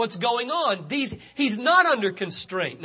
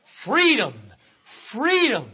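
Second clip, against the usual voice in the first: "Freedom" said twice, pitched up, with a falling close; preacher hammering home "Freedom"; stressed repetition. Shown as a demonstration. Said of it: "I stress the word freedom".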